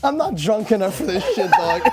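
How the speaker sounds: words squeezed out through laughter; laughing while talking. Real, not acted. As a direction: try to talk but keep laughing. Laughing fit mid-sentence.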